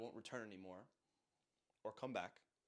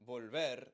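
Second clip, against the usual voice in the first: "volver" said with "ver" punched louder; stress on last syllable; 7 dB louder than usual. Shown as a demonstration. Teacher demonstrating the stressed last syllable: vol-VER, punched loud. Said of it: "this last syllable is stressed".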